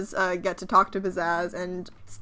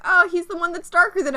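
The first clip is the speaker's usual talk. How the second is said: higher, quick and eager; high helpful kid voice; 8 semitones higher, 4 dB louder, faster than usual. Retold or imitated. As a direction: point him out brightly. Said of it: "Utu is like".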